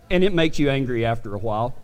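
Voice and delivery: ordinary talk, nothing marked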